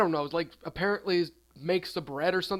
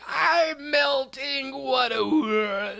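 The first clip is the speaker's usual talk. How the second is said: high, drawn-out wail sinking as it goes; hammed-up melting cry, slowly falling away; melting witch; 7 semitones higher, much slower than usual. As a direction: wail like a dying witch dissolving. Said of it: "she starts melting".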